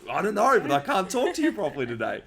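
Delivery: high, loud, strained plea; anxious. Retold or imitated. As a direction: blurt it out like an anxious ball of nerves. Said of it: "like an anxious ball going".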